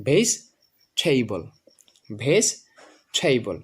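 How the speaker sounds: ভেজিটেবল slowly split, "veis... theibol"; correct pronunciation. Shown as a demonstration. Teacher modelling the correct form, ভেজিটেবল as slow "veis... theibol". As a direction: drop the middle vowel of ভেজিটেবল, aspirate the t: "veis... theibol". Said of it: "এই জন্য আমরা উচ্চারণ করবো".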